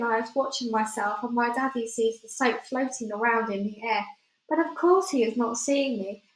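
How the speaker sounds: usual speaking voice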